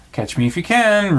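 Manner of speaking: high, sing-out dare; playful call. A narrator's reading. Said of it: "Roo called".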